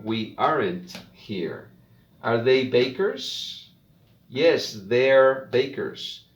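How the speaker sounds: slow, clear model reading; slow practice reading. Shown as a demonstration. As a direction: read each line slowly for students to repeat. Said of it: "solo voy a leer".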